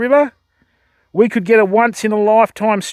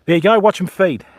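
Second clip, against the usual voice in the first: ordinary delivery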